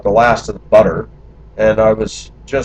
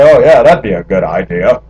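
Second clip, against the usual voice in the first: sarcastic; 6 dB louder than usual. Retold or imitated. Man joking with friends about their suggestion, sarcastic. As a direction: say it with sarcastic false enthusiasm. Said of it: "when I was sarcastic".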